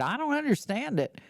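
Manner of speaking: put-on playful Texas accent; Texas girlfriend. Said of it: "she's from Texas".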